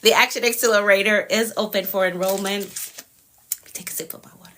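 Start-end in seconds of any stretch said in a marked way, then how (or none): 3.7-4.5 breathy whispered aside; whispering. Real, not acted. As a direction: whisper it under your breath. Aside while reaching for water.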